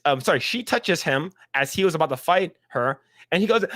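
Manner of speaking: normal talking voice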